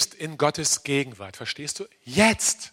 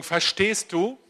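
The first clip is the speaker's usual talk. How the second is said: normal talking voice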